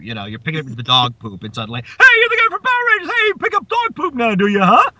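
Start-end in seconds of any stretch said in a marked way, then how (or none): 1.8-4.9 very high, fast put-on heckler voice; hammed-up high-pitched taunt; mocking passerby. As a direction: pester him like a nosy stranger. Imitates passerby spotting him mid pickup.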